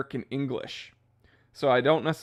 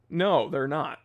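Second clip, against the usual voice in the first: usual speaking voice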